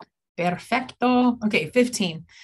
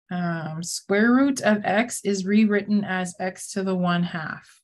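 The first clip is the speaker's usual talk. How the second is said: ordinary talk, nothing marked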